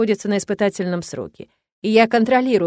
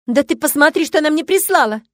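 loud, high furious outburst; raging; 6 semitones higher, 6 dB louder than usual. A narrator's reading. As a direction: storm at him, outraged. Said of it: "бушует Виктория".

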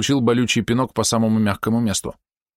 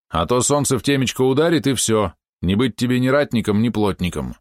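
ordinary delivery

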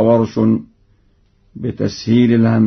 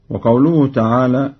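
usual speaking voice

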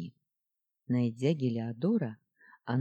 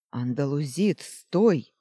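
loud warning cry; urgent shout; 6 dB louder, slower than usual. Acted. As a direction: shout to stop him. Heliodor shouts warning to friend. (громкий окрик)

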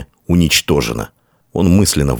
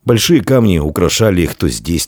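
ordinary delivery, nothing marked

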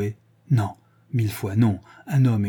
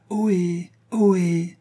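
high, drawn-out far-away holler; distant answering call; 8 semitones higher, much slower than usual. A narrator's reading. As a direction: call back from way up above, pitched high. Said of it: "une voix plus lointaine qui venait de tout en haut répondait".

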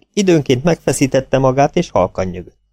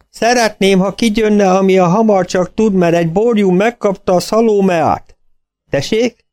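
farmer voice; 10 semitones higher than usual. A narrator's reading. Farmer on phone.

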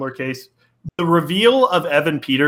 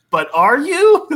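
high, louder doubting question; mock skeptical; 11 semitones higher, 5 dB louder, slower than usual. Doubting the actress is trying at the accent, mock skeptical. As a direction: swing way up in teasing disbelief.

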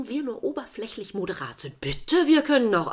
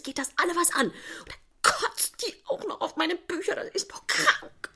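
ordinary delivery

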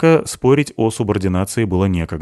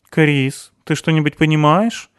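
mildly baffled, higher-pitched question; puzzled; 9 semitones higher than usual. A narrator's reading. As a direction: ask as if a little lost. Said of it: "озадаченно спросила блондинка". (слегка озадаченно)

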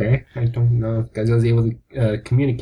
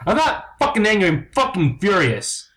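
furious denial; 5 semitones higher than usual. Acted. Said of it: "You seem like an angry person".

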